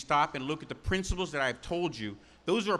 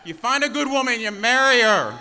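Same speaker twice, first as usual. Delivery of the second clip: louder, higher, hammering it home; emphatic repeat; 5 semitones higher, 10 dB louder than usual. Shown as a demonstration. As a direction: repeat it with a shouted punch. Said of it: "I had some ladies want me to reinforce that".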